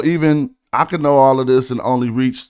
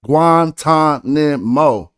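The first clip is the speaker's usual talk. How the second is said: slow, each syllable of Guantanamo drawn out and louder; syllables spelled out; 4 dB louder, much slower than usual. Shown as a demonstration. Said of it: "Guantanamo is more than three syllables".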